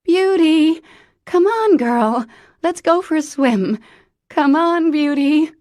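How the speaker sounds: loud, high frantic calls for the dog; calling out. Acted. Mother searching the beach calls her dog, frantic high shouting. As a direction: yell into the dark, desperate.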